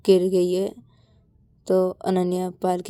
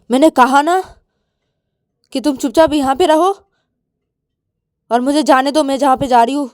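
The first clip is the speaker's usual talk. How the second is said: loud, raised angry outburst; heated, forceful threat; 4 semitones higher, 8 dB louder than usual. Acted. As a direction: snap at a friend to stay put. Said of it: "Ananya गुस्से में आके".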